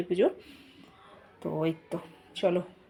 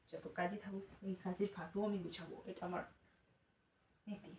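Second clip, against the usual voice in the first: speaking softly; 12 dB quieter than usual. Real, not acted. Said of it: "সে কারণে আস্তে আস্তেই কথা বলছি".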